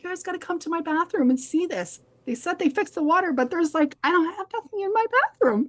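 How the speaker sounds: calling her friends to see the bathroom, excited and fast; excited urging. Retold or imitated. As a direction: rush them over, all worked up. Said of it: "I'm like".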